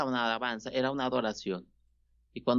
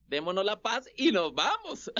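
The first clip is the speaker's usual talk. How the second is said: mock priest; 7 semitones higher than usual. Mocking the quick traditional mass.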